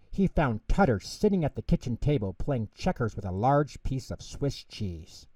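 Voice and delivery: ordinary delivery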